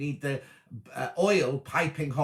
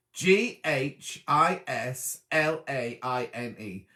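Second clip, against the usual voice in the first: ordinary talk, nothing marked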